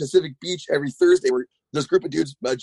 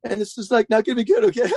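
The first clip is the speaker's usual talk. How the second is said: high, voice-cracking Yoda-like coaching voice; Roddy Mullen Yoda voice; 8 semitones higher than usual. Retold or imitated. Roddy Mullen coaching the speaker over speakerphone, high cracking Yoda voice. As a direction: do Roddy as a squeaky cracking Yoda, all tech. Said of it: "kind of like a voice cracking version of Yoda".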